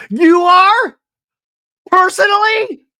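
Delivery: shrieked, very high, loud questions; over-the-top excitement. Retold or imitated. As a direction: imitate someone suddenly cranked up to the maximum. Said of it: "all of a sudden is on a twenty".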